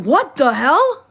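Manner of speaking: drawn-out shocked disbelief; stunned. Retold or imitated. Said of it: "I was like".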